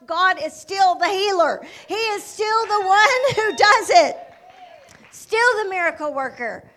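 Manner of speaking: loud, slow, emphatic proclamation; full-voiced testimony, drawn out; exultant preaching. Real, not acted. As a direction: drive each phrase home to the congregation. Preacher exclaims God still heals.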